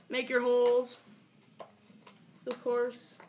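usual speaking voice

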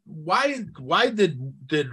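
ordinary talk

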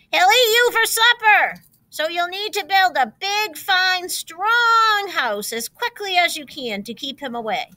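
calling out. A narrator's reading. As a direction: keep calling the warning after them. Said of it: "called their mother".

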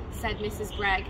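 ordinary delivery

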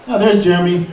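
usual speaking voice

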